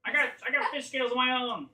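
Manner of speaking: high, loud, dismissive squawk; scornful creature leader. Acted. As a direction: reject the offer in a high monster voice.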